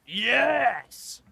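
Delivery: high, drawn-out exultant yes; triumphant cheer. Real, not acted. Host hearing the right dinosaur guessed, triumphantly excited. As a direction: celebrate with a long, rising cry.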